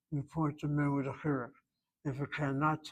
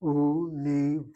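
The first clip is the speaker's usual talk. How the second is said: "Università" broken into five separate syllables, louder; 5 dB louder than usual. Shown as a demonstration. Teacher showing the five syllables, spoken one by one. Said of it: "Five syllables make up that word".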